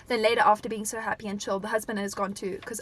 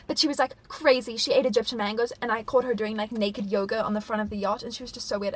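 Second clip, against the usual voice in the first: ordinary talk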